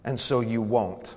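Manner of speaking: tag. Retold or imitated. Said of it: "To whisper in your ear".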